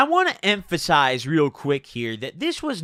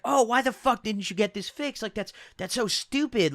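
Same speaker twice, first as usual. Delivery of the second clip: mock pissed off; 5 semitones higher, 3 dB quieter than usual. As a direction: mimic her irritated complaint in a raised pitch. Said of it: "like, she was pissed".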